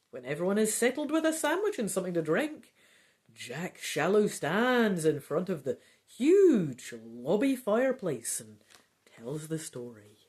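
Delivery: ordinary talk, nothing marked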